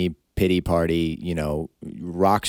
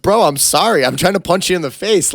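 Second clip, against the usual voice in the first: much higher and louder, hammed-up sorry plea; mock apology; 12 semitones higher, 8 dB louder than usual. Fighter apologizing to the opponent, mock high plea. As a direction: plead innocence in an over-the-top squeak.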